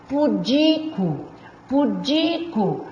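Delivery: "pudico" slowly, DI drawn out; stress on DI. Shown as a demonstration. Teacher drilling the tonic syllable, long DI in "pudico". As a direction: stretch the middle syllable of "pudico". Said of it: "A sílaba tônica é o di".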